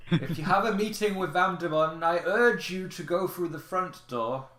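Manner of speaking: high, loud guard creature voice; indignant gatekeeper. Acted. Guard Woodmon warns off intruders.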